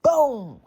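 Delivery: a sharp, high, loud "boom!" impact noise; mock crash sound. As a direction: smack it out like a hit landing. Impact sound in fight story.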